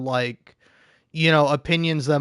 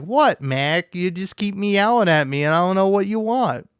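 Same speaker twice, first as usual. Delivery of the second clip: raised, fed-up voice to the cat; pitched-up plea to a meowing cat; exasperated talk to cat; 5 semitones higher than usual. Retold or imitated. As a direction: ask the cat what it wants, pitched up. Talking to her meowing cat.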